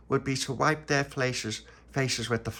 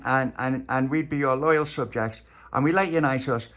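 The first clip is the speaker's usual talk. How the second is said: put-on raised, indignant kid voice; protesting boy; 5 semitones higher, 5 dB louder than usual. Tony pleading his case to the queen, indignant. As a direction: argue your innocence.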